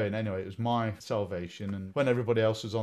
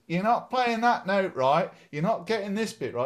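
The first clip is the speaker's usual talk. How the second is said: shouting mum; 10 semitones higher, 8 dB louder than usual. Retold or imitated. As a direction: call out like a nagging mother. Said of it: "Mum would shout from the kitchen".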